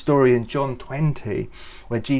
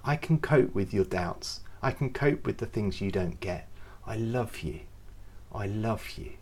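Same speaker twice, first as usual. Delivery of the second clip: very soft, tender reassurance; quiet, loving reassurance; gentle; 8 dB quieter than usual. Pretend. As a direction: soothe a doubter. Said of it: "he's always like".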